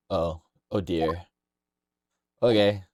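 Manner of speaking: normal talking voice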